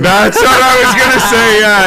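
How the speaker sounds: high, loud eager agreement; excited. Real, not acted. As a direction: jumps in excitedly.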